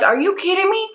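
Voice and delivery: very high squeal of disbelief; retold squeal at the attendant; outraged disbelief. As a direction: shriek it in mock outrage. Outraged at the fee.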